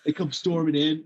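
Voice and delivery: ordinary talk, nothing marked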